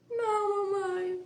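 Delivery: put-on high, tearful little boy's voice; whimpering child. Mother acting out her son's tearful reply, high mock whimper. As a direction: play the son answering with a mock cry.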